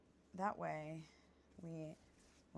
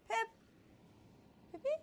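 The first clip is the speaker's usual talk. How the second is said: calling out; 4 dB louder, faster than usual. Real, not acted. Calling for the dog.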